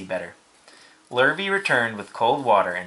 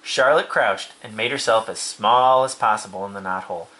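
plain reading voice

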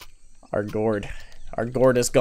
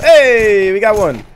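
excited shout; 7 semitones higher, 9 dB louder, much slower than usual. Real, not acted. Exclaiming a round win.

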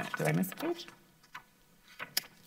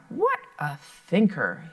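exclaiming; slower than usual. Acted. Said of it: "he exclaimed".

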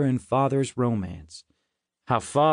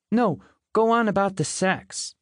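high, earnest insistence; humorless insistence; 9 semitones higher than usual. A narrator's reading. Little Lily urging mother on, humorlessly. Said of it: "very humorlessly".

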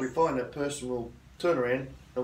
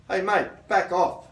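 firm and clear, a bit louder; firm, assertive; 3 semitones higher, 4 dB louder than usual. Shown as a demonstration. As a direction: tell them calmly but firmly to back off. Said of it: "say it loud enough so as everyone".

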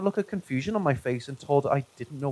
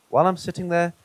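ordinary delivery

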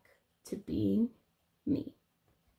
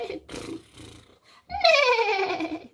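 high, loud mock neigh; whinny like a playful horse; 11 semitones higher, 4 dB louder than usual.

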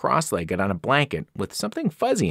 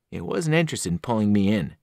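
plain reading voice

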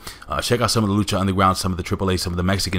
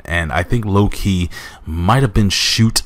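usual speaking voice

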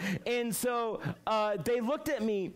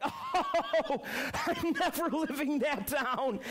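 laughing, the voice pitched up; 5 semitones higher, slower than usual. Real, not acted. Laughing at himself while telling the youth group the story. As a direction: laugh through it at yourself. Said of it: "I was like".

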